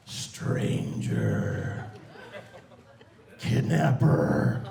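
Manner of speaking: eerie whispered accusation. Retold or imitated. Son whispering to dad at the zoo, menacing whisper. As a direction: breathe the words like a creepy threat. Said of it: "and he whispered".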